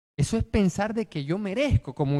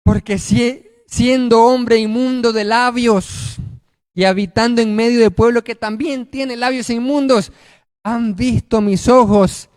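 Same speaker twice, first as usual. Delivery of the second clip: lamenting confession; 3 semitones higher, 6 dB louder, slower than usual. Acted. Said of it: "Porque dice".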